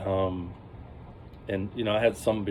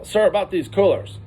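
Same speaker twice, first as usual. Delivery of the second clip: stern trooper; 10 semitones higher, 7 dB louder than usual. Retold or imitated. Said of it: "He goes".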